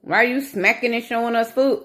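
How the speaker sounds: imitating a snippy viewer picking at her eating; mocking commenter. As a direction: needle the vlogger like a rude commenter. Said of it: "that type of comment".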